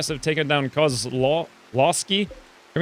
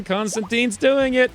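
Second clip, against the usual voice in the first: excited; 8 semitones higher, slower than usual. Real, not acted. Watching a rescue succeed.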